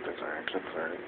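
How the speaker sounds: quiet, low muttered reassurance; under his breath, it's fine; soft self-reassurance. Real, not acted. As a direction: talk yourself down softly. Consoling himself softly.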